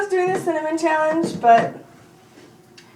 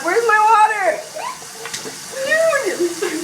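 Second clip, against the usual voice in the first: desperate yell; 9 semitones higher, 5 dB louder, much slower than usual. Real, not acted. Cinnamon in mouth, desperate.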